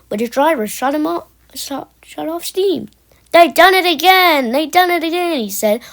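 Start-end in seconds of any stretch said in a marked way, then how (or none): 3.3-5.4 high, loud cry of dismay; alarmed exclamation. A narrator's reading. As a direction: call out the bad news, then ease off. Driver exclaims as trucks break away.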